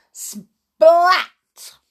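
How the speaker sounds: high, loud burst; splat effect. A narrator's reading. Boy landing on Amber, explosive splat. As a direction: make it land with impact. Narrator voices impact.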